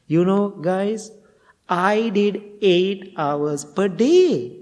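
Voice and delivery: slow, drawn-out boastful brag. Retold or imitated. Retreat student bragging again to the monk, slow and drawn out. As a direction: stretch each word to milk the praise.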